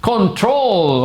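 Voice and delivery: "Control" with stress on TROL; correct CONTROL. Shown as a demonstration. Teacher introducing another word to students, correct CONTROL. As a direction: model "Control" high and clear, end-stressed. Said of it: "Please don't say control".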